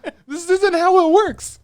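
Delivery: loud, high exasperated outburst; exasperated shout. Real, not acted. Podcaster fed up with the boat scene, loud exasperated outburst. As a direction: blurt it out in disbelief.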